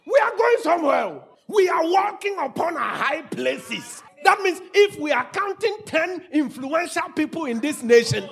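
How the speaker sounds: high, loud triumphant cry; full-force preaching shout. Real, not acted. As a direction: lift the house with it. Preacher erupts with rising exhortation.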